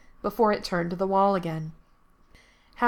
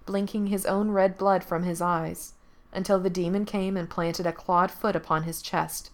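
ordinary delivery

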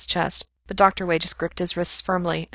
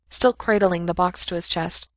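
ordinary delivery, nothing marked

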